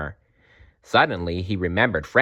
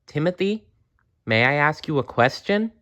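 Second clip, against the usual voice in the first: gentle bear voice; 6 semitones higher than usual. A narrator's reading.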